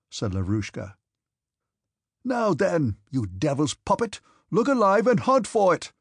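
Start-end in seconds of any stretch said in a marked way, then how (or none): 2.2-5.9 high, loud angry command; angry shout. A narrator's reading. Denisov to his orderly over the missing purse, angry shout. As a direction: shout it furiously, rising to the last words. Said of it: "shouted Denisov".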